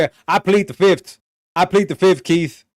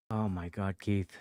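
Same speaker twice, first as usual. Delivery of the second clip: quiet groaning disbelief; 6 semitones lower, 6 dB quieter, slower than usual. Real, not acted. Muttering in disbelief.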